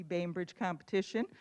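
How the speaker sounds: ordinary talk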